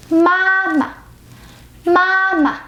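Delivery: mama with a long high level first syllable, then a short falling "ma"; neutral second tone. Shown as a demonstration. Teacher models the word for mother, second syllable neutral. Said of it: "We don't say mama".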